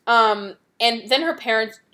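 usual speaking voice

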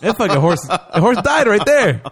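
laughing disbelief. Real, not acted. Telling a friend the horse died, laughing. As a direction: laugh through it.

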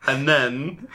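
ordinary talk

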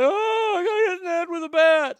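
high, whiny mock-sobbing child; imitating crying kid. Hurt boy whining to his mom, mock crying. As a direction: wail it like a hurt twelve-year-old. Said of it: "you're gonna cry when you get home".

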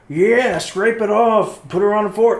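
high, slowed mock voice; dragging the words out in a silly mock voice; mock Republican. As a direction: ham up a hungry partisan. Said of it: "the other half were like".

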